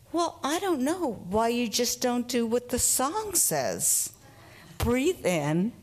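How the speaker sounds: lightly voiced little girl scolding the adult; child's retort. Four-year-old scolds the therapist with the song's advice, rising indignant. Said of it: "turns around to me and says".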